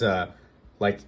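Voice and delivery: ordinary talk, nothing marked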